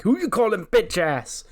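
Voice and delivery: high, loud indignant retort; angry comeback. Retold or imitated. Braun answering Roman's insult at the door, high and loud. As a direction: fire it back furious. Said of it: "Braun Breaker's like".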